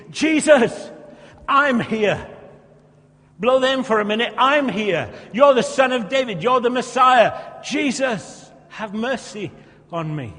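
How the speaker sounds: high, loud shout fading at the end; cling on and cry out; tenacious shout. Acted. Beggar crying tenaciously to Jesus, loud then fading. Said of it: "Jesus responds to faith, to tenacity".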